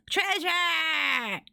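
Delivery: high, drawn-out gleeful cry; excited shout. Real, not acted. Player zooming in on treasure, excited. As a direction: sing out the prize.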